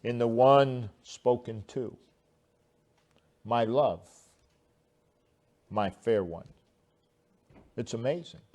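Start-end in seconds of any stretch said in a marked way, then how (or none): 3.4-6.5 softer, lingering on love and fair; tender delight. Shown as a demonstration. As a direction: say it like you delight in her. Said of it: "words of one who has a delight".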